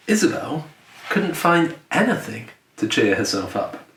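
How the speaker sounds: plain reading voice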